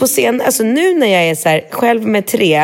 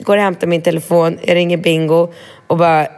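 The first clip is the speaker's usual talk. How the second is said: usual speaking voice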